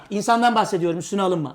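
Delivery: normal talking voice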